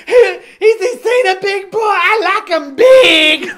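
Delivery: very high, loud, slow mock woman; shrieking falsetto. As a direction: squeal it through laughter.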